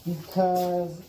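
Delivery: muffled through a respirator; through a face mask. Real, not acted. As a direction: talk muffled behind a dust mask. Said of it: "I'm gonna start talking funny because I'm wearing a respirator".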